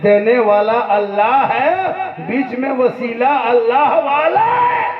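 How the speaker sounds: slow, building preacher's proclamation; swelling sermon climax, rising in pitch at the end; rousing declaration. Real, not acted. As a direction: draw it out and climb to a ringing finish.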